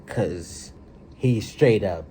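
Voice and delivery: ordinary talk, nothing marked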